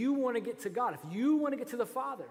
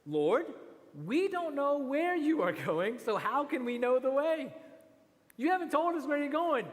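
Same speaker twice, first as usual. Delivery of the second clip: higher, louder pleading disciple; 6 semitones higher, 4 dB louder than usual. Acted. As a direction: beg for an answer, pitched up. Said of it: "He says".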